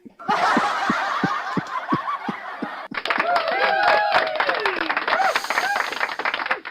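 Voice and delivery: long high burst of real laughter; big laugh. Real, not acted. Host cracking up at the dad joke punchline, real laughter. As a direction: crack up at your own joke.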